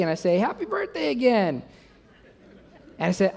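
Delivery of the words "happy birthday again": high-pitched, eager greeting; acted cheer. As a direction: play the over-eager husband. Acting eager husband greeting wife.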